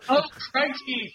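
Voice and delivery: put-on high, laughing northern English accent. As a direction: cry it out in a comic northern English voice. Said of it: "more like a northern English accent".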